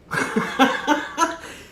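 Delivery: big airy laugh at his own joke; burst of laughter. Real, not acted. Speaker cracks up at his pyramid joke, laughing. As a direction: laugh out loud at your own punchline.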